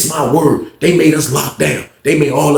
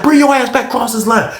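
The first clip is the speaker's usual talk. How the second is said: high-pitched imitated order; acted angry dare; 10 semitones higher than usual. Officer daring the inmate across the line, angry. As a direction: imitate her daring him, voice up.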